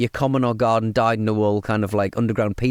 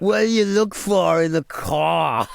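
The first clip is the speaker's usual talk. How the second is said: slow, hammed-up street interviewer; higher put-on voice, words dragged out; YouTuber impression; 6 semitones higher, slower than usual. Mocking a YouTuber stopping people in the street, drawn-out interviewer impression. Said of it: "Your talents are wasted".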